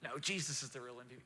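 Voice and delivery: very soft, low, quick aside; inner church person. Acted. Church person inside the preacher corrects him, hushed and quick. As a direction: murmur it as a small correcting inner voice. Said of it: "I heard the church person inside of me".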